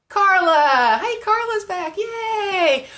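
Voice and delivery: high, loud cheer; excited high-pitched welcome back; delighted greeting. Real, not acted. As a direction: call out high to a returning friend. Calling out to returning viewer.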